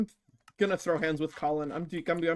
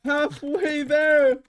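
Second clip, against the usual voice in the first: high, loud, slow cheer; drawn-out cheer; 11 semitones higher, 6 dB louder, much slower than usual. Real, not acted. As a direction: stretch the cheer out. Charity goal halfway reached.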